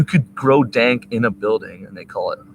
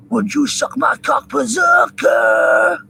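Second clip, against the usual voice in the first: high, loud shout with a long-drawn last word; mock berserker yell; 5 dB louder, slower than usual. Reenacting a Clerks scene, hammed-up high shout. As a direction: belt it out, stretching the final word.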